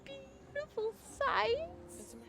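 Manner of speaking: very high, drawn-out echo; hammed-up high-pitched swooning; mock flirtation. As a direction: stretch it slowly in a silly high voice. Hamming up the flirty guide's line.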